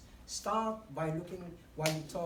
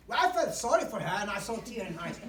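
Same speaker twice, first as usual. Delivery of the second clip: loud and bright, no sadness; chirpy loud version; wrong cheerful delivery; 4 semitones higher, 8 dB louder, faster than usual. Shown as a demonstration. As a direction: rattle it off loudly. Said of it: "You don't say".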